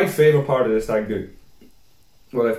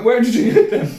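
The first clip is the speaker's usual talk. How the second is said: incredulous; 9 semitones higher, 5 dB louder than usual. Retold or imitated. Friend questions drunk speaker.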